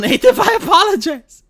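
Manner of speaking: laughing while talking, pitch climbing; laughing apology. Real, not acted. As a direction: crack up into a high laugh on the last words. Laughs through a joking apology.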